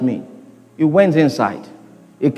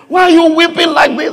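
alarmed mother; 10 semitones higher than usual. Retold or imitated. Mother alarmed at son weeping.

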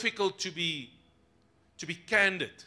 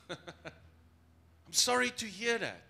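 mock pity; 3 semitones higher, 3 dB quieter, much slower than usual. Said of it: "People pity".

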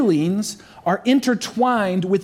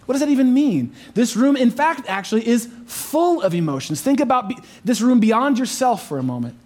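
usual speaking voice

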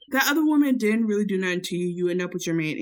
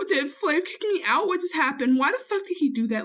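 New York voice; 7 semitones higher than usual. Retold or imitated. Voicing confused New York.

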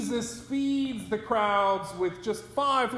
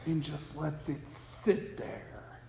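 dropped to a low, unvoiced whisper; 7 semitones lower, 9 dB quieter than usual. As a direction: whisper it and let it hang. Letting a hard saying sink in.

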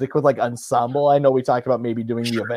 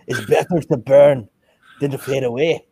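put-on Scottish accent. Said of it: "look up Highlander quotes".

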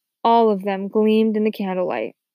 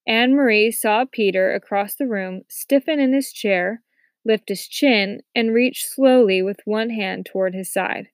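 usual speaking voice

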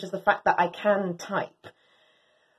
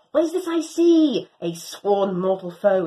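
plain reading voice

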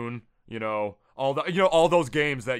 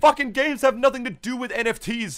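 raised, high-pitched exasperated arguing; heated rant; 9 semitones higher, 4 dB louder than usual. Real, not acted. Co-host arguing games don't need NFTs, heated rant. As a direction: argue it hotly at higher pitch.

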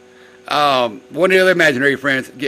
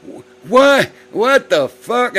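shocked disbelief; 10 semitones higher, 5 dB louder, much slower than usual. Retold or imitated. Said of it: "And I'm like".

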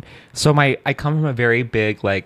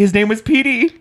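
high mock mournful; 10 semitones higher than usual. Mock sad tribute.

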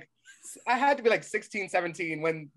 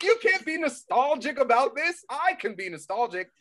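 high, exasperated protest; mock indignation; 11 semitones higher, 4 dB louder than usual. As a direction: scold the kids in playful outrage. Acts out indignant reply to pupils.

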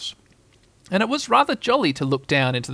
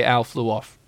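plain reading voice

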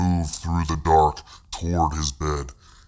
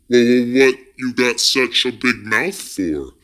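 high, stuttering frightened voice; scared boy asks the creature, stammering; stammering in fear; 6 semitones higher, 3 dB louder than usual. A narrator's reading. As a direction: stutter it out terrified.

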